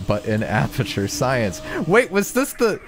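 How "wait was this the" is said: sudden excited realization; eager, cutting in on himself. Real, not acted. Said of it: "I got excited".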